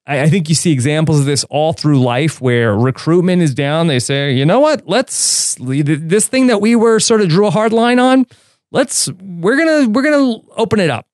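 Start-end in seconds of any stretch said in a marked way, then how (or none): 4.3-11.1 high mock voice. Voicing Night's Watch leaders.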